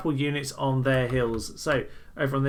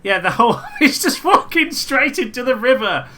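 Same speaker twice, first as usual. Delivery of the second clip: talking through real laughter, pitched up; laughing; 10 semitones higher, 10 dB louder than usual. Real, not acted. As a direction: laugh it out in disbelief. Laughs at army walking into river.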